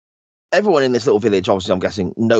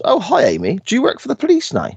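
put-on much higher, quick, sing-song old lady greeting; chirpy old neighbour; 13 semitones higher than usual. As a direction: pipe up brightly like a nosy old neighbour.

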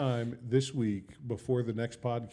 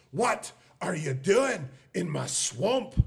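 very slow, higher Shrek voice; Shrek impression; 6 semitones higher, much slower than usual. Host attempts Shrek for the podcast, slow and higher. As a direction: drag out Shrek's swamp line. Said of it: "I can do, uh, Shrek".

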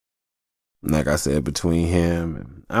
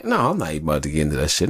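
ordinary delivery

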